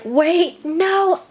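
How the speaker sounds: high sudden alarm; panicked realization. Retold or imitated. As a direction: snap into panic.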